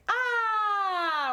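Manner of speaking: high excited squeal of delight. Retold or imitated. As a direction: squeal like a thrilled fan. Gushing over her latest piece.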